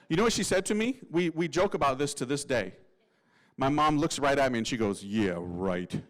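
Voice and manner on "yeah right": very low, soft deadpan dismissal; sarcastic mom. Retold or imitated. Mom answers son's request for a priest, dryly sarcastic. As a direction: imitate mom's dry, low-voiced disbelief. Mom's sarcastic reply acted out.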